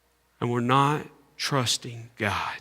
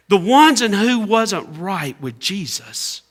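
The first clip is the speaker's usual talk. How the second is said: high, loud preaching climax; preaching at full force; 10 semitones higher, 13 dB louder than usual. Acted. Preacher voicing the panicking disciples to the church.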